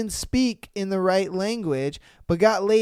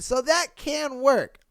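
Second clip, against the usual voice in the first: normal talking voice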